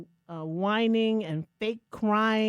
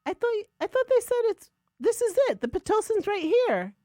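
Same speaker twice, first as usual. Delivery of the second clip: husband's discovery; 13 semitones higher, 4 dB louder than usual. Retold or imitated. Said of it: "And he said".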